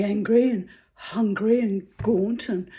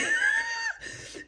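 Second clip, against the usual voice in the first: real laugh, very high-pitched; high squealing laugh; 4 dB quieter than usual. Real, not acted. Laughing at her own crude joke, high squeal.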